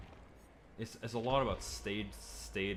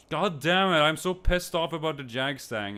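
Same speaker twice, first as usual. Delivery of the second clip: frustrated outburst; 6 semitones higher, 8 dB louder than usual. Real, not acted.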